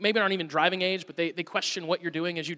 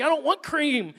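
put-on high whiny kid, much higher pitch; mock kid disgust; 10 semitones higher, 3 dB louder than usual. Son refusing to eat cream donuts, high whiny mock kid. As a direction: whine it like a grossed-out little boy. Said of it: "he's picturing something that just in his mind is gross".

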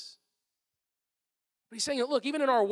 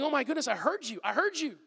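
louder, fed-up exasperation; exasperated brush-off; 6 semitones higher, 6 dB louder than usual. Retold or imitated. Imagined annoyed God dismissing a persistent asker, exasperated. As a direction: wave them off like an irritated parent. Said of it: "He's not going".

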